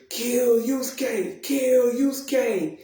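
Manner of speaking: high, drawn-out mock demon cry; screaming demons. Demons at the tournament calling for Yusuke's death, mock screaming. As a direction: imitate demons chanting for blood, slow and high. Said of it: "all these demons screaming".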